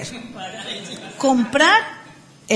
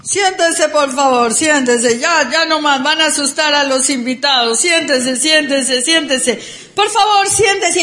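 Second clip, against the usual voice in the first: loud, pleading call over the crowd; imitating the speaker; 2 semitones higher, 5 dB louder than usual. Imitating the speaker begging the cheering crowd to sit, loud.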